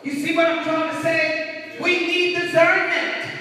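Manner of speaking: loud, high, emphatic sermon delivery; forceful preaching. Real, not acted. Preacher warning a church of deception, forceful preaching. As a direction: preach it out, leaning hard on key words.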